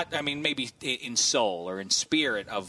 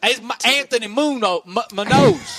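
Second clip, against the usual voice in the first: imitating a Southern twang; mock Southern accent; 11 semitones higher, 10 dB louder than usual. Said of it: "It's my, my southern accent".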